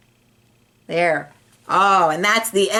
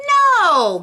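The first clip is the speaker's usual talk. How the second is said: emphatic no; 12 semitones higher, 5 dB louder than usual. Acted. Gilbert protesting.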